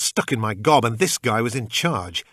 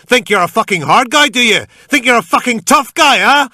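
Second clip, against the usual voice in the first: very high, very loud, ranting scream; frenzied screaming; 13 dB louder, slower than usual. A narrator's reading. Gunman screaming at the pinned man while dragging Kelly. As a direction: shriek it like a man gone wild. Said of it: "All I could hear was".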